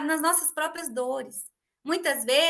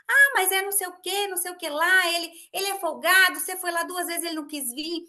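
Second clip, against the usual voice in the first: annoyed retort; 5 semitones higher, 4 dB louder than usual. Retold or imitated. Husband arguing back, annoyed.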